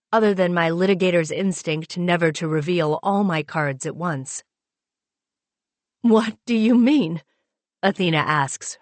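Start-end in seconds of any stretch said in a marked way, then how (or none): 6.0-7.2 higher, voice trembling with fear; shaking voice. Acted. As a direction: let the voice shake, startled. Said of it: "her voice shaking".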